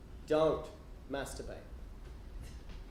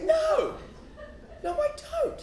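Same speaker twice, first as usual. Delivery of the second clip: very high, squealed no; squeaky denial; slower than usual. Retold or imitated. Boy denying it to the yelling church lady, very high. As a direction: deny it in a tiny squeak.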